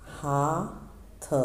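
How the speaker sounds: हाथ stretched very slowly, each sound held; slow drawn-out example. Shown as a demonstration. Teacher slowly drawing out हाथ for pupils to hear the vowel. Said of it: "जैसे हाथ".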